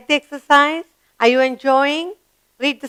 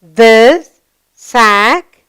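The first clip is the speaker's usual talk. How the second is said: ordinary delivery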